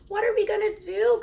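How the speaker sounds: a mother at her wits' end; mother crying out in despair; distressed cry. A narrator's reading. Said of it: "cried Missus Crean".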